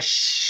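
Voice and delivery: long, forceful "shh"; imitated grandma's sustained hushing hiss; in-your-face shush. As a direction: shush someone right up in their face. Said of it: "straight up in your face like".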